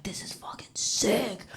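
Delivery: hushed amazed whisper; awed whisper. Retold or imitated. Kid thrilled by Dreamtime lessons, hushed awed whisper. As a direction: breathe it out in awe. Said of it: "I was like".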